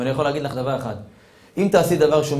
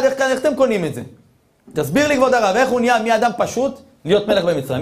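much higher put-on voice of a scoffing questioner; mock skeptic; 8 semitones higher than usual. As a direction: play the doubter demanding answers. Skeptic challenging the rabbi.

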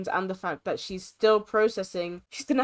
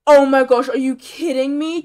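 loud, higher cry of disbelief; incredulous outburst; 3 semitones higher, 10 dB louder than usual. Real, not acted. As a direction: burst out in exasperated disbelief. Slow dilation news after hours.

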